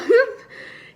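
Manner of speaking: squealing high laugh. Real, not acted. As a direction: break into a giggle. Laughs again.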